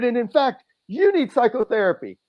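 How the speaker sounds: high and loud, angry; furious outburst. Retold or imitated. Insulting the boss at the review, high loud fury. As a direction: blurt it out at the top of the anger. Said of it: "getting angrier and angrier".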